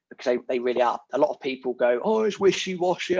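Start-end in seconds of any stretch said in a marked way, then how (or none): 2.0-3.2 complaining. Retold or imitated. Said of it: "and start complaining".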